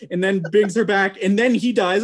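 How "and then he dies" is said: ordinary delivery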